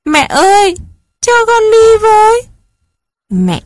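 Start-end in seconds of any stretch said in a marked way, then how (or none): 0.0-2.4 high, loud wailing plea, chasing after mother; a child crying out desperately for mom; sobbing child begging to come along. Acted. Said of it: "gào khóc".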